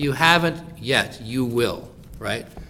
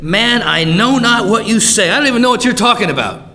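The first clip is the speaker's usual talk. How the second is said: vehement denial; 7 semitones higher, 8 dB louder than usual. Acted. Peter denies Jesus a third time, vehement loud protest. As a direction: deny it loudly, speeding up at the end.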